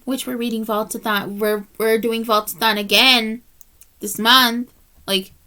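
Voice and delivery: ordinary talk, nothing marked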